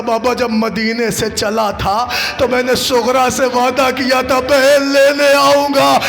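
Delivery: high, loud grieving chant of the tale; wailing lament recitation. Acted. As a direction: cry the scene out to the mourners. Climax of the lament. (ऊँची आवाज़ में मातमी मरसिया ख़्वानी)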